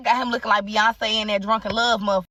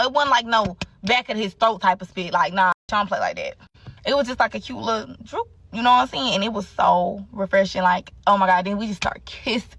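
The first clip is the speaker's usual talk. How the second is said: normal talking voice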